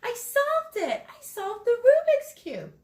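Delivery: bursting with pride at his first solve; excited shout. Acted. Andres telling his mom he solved the cube, thrilled shout. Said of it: "Andres shouted".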